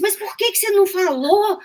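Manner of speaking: high, sharp mimic of the old lady's question; imitating her outburst. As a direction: pitch it way up like a blurting woman. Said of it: "Ela falou assim". (imitando a senhora, bem agudo)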